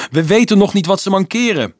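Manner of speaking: loud, high urgent shout; called-out warning. A narrator's reading. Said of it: "riep Dolf hem toe".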